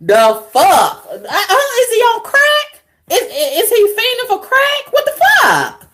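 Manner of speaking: high-pitched, baffled questioning; incredulous. Real, not acted. Vlogger baffled by the man's odd behaviour, incredulous. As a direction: climb high in mocking disbelief.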